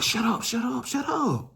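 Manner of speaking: begging them quietly to stop; hushing them. Retold or imitated. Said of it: "I'm like".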